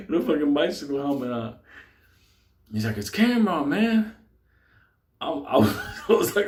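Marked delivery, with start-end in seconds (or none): 3.0-4.1 high, lightly voiced friend; imitating Cameron. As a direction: chirp the name like an old buddy. Friend announces himself after years.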